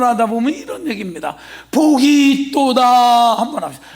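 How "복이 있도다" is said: slow, louder preacher's declaration; drawn-out proclamation. Shown as a demonstration. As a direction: stretch out each word and proclaim it. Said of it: "여기는 나처럼 좀 해".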